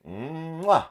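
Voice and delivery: big smacking air kiss; loud mock kiss. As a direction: blow a loud theatrical kiss. Kissing at the photo.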